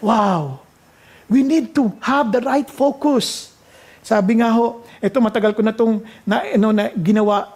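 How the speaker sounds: normal talking voice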